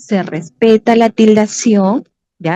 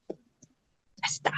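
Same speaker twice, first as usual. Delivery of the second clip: whisper; 18 dB quieter, much slower than usual. Real, not acted. Teacher mutters while fixing slides.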